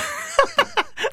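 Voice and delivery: very high squeal of laughter; uncontrollable laugh. Real, not acted. Host failing to get past the Pirates, uncontrollable high laugh. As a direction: burst out laughing mid-sentence. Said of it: "can't even say the Pirates without, without laughing".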